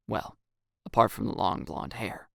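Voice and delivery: plain reading voice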